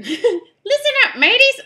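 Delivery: very high, slow parrot squawk. Acted. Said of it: "That's a parrot".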